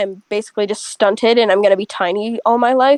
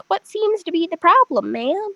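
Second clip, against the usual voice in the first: put-on sweet old lady; 6 semitones higher than usual. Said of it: "she sounds like that".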